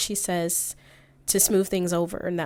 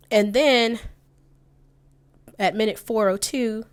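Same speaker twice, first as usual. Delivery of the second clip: louder and higher, to flag the point; emphatic; 3 semitones higher, 7 dB louder than usual. Real, not acted. Commentator leading into the key moment, loud and emphatic. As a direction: punch it to grab attention.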